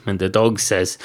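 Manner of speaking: usual speaking voice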